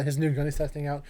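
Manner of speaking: usual speaking voice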